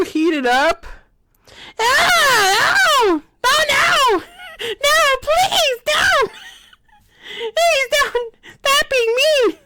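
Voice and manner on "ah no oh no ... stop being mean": mock pleading. Mocking the character as it begs not to be hurt. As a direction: squeal no, please, don't like a hammed-up victim.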